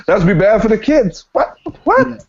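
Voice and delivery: normal talking voice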